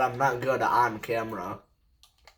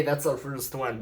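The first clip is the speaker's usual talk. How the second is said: usual speaking voice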